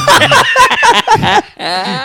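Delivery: high-pitched cackle; high shrieking laugh. Real, not acted. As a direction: burst into a squealing laugh. Laughing at the nuts joke.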